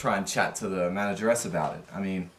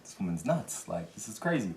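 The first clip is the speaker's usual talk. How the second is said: softer disbelieving aside to a friend; hushed aside; 2 semitones lower, 10 dB quieter than usual. Retold or imitated. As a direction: mutter it so she cannot hear. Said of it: "looking at each other like".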